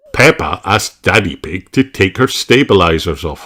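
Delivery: usual speaking voice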